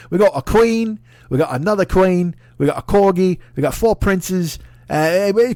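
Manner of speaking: high, loud hammed-up English bluster, slow drawn-out ending; mock English patriot. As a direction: bluster proudly, drag out the last words. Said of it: "you're like".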